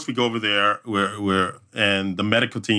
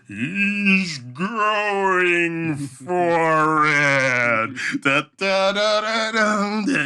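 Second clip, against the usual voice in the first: higher, drawn-out announcer call, then a hummed fanfare; race announcer on the mic; 6 semitones higher, much slower than usual. Retold or imitated. MC on the mic hyping the athlete's run, high and drawn out. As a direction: call it like an event MC hyping a runner, stretched slow. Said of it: "I'm on the mic".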